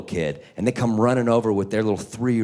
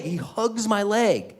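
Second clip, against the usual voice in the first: put-on clingy kid voice; 10 semitones higher, slower than usual.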